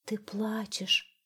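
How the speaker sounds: slow, whispered sigh. A narrator's reading. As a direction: breathe it out as a sigh. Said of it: "вздохнул туман".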